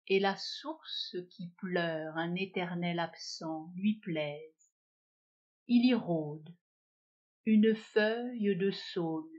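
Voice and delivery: slow, measured verse with long pauses; poem recitation. Acted. Teacher reciting Sully Prudhomme's poem Le Cygne to learners, measured poem recitation. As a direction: recite it unhurriedly, lowering the voice at line ends.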